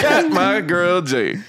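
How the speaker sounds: stretched out slow, laughing through it; slow laughing greeting. Real, not acted. Greeting his friend, slow and laughing.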